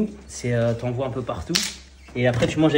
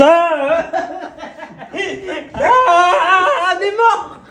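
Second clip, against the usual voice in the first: high laughing cry; laughing it off in despair; 7 dB louder, much slower than usual. Real, not acted. Laughing after failed jar.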